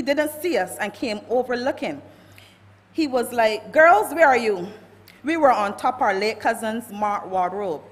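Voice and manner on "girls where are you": a loud call across the house. Retold or imitated. Uncle searching the house calls loudly for the girls. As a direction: raise the voice to find them.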